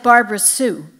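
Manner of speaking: a hint of a fed-up mom calling her daughter's full name; annoyed mother. Mother scolding daughter, mild mock annoyed. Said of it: "which she said when she was really annoyed".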